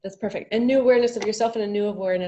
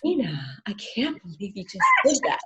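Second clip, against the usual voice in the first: sexy voice. Shown as a demonstration. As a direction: coo it like flirting. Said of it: "talk to it in, like, a sexy voice".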